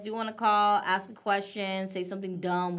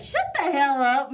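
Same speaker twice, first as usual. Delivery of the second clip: louder, higher shout of shut up; louder, higher playful yell at a teasing friend; loud laughing outburst; 6 semitones higher, 7 dB louder than usual. Real, not acted. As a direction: yell it at your co-host in disbelief.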